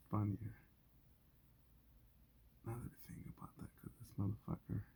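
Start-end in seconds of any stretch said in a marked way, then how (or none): 2.6-3.7 muttered whisper to himself; hushed musing. Real, not acted. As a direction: think aloud in a whisper.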